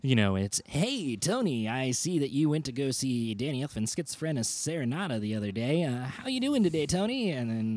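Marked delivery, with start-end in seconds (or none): 0.7-7.3 creepy telemarketer voice. Retold or imitated. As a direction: sell it with a creepy smile. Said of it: "the creepiest, like, telemarketer voice".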